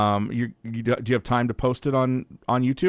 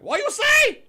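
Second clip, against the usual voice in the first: squeaky high, confused voice; confused caller; 7 dB louder, slower than usual. Retold or imitated. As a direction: play a baffled caller, way up high. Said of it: "They're like".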